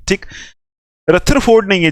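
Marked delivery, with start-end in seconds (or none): 1.1-1.7 "रथरफोर्ड" with a strong aspirated th; "रथरफोर्ड" with th as written; mispronounced name. Shown as a demonstration. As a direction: hold up the misreading "रथरफोर्ड", थ not द. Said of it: "इसको रथरफोर्ड पढ़ रहे हैं वो गलत है".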